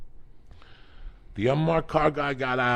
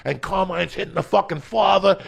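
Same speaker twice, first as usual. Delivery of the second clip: ordinary talk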